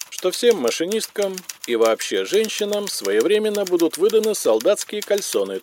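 high-pitched official dictation; sustained high bureaucratic monotone; telephonogram dictation. A narrator's reading. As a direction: dictate it formally over the telephone. Same words as telephonogram dictation.

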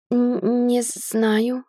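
hesitant, halting "н-, не знаю"; stammering. A narrator's reading. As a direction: stutter on every sound of "не знаю". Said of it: "заикаться на каждой букве".

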